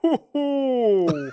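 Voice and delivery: hooting, high ho-ho; Santa Claus. Pretend. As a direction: Santa laugh as if holding in a burp. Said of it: "like you're holding in a burp".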